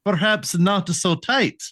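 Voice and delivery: put-on thick Russian accent, high. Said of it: "in a Russian accent".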